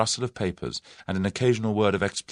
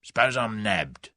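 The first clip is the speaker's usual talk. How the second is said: cockney thug worried; 3 semitones higher than usual. A narrator's reading.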